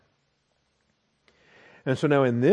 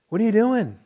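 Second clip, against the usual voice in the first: higher-pitched incredulous scolding; mock-baffled, pitched up in disbelief; mock exasperation; 7 semitones higher, 3 dB louder than usual. Preacher chiding grown believers still acting like babies, mock exasperation.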